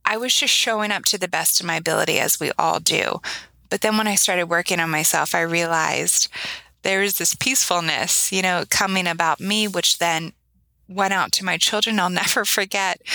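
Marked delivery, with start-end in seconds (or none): none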